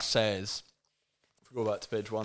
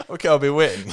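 loud, higher, hammed-up line through amusement; mock emphatic reply; 5 semitones higher, 13 dB louder, faster than usual. Co-host mocking Lisa's vague coffee plan, loud and hammy. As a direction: belt out the reply in mockery.

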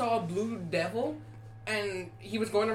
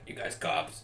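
put-on dropped accent, hushed; 5 semitones lower, 6 dB quieter than usual. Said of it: "changes from his fucking Italian accent".

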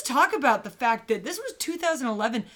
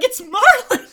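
much higher and louder, stunned; shocked realization; 13 semitones higher, 15 dB louder than usual. Retold or imitated. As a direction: blurt out the name in disbelief. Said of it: "The whole time I'm like".

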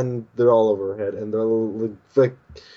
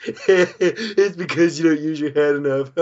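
giggled teasing jab, drawn out; teasing girl; 4 semitones higher than usual. Retold or imitated. Girl mocking her friend's mushroom head, teasing with a laugh. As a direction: tease them through a laugh. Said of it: "Kaku goes".

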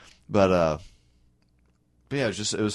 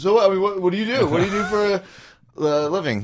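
higher-pitched mock small talk; lightly caricatured, higher-voiced questioner; chatty driver imitation; 10 semitones higher, faster than usual. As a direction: imitate a nosy driver making conversation.

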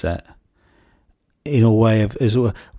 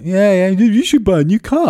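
sarcastic dad; 9 semitones higher, 5 dB louder than usual. Retold or imitated. Dad answering son mockingly.

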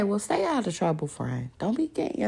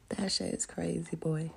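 usual speaking voice

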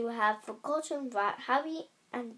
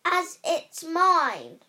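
slow furious insistence; 5 semitones higher, 8 dB louder, slower than usual. Acted.

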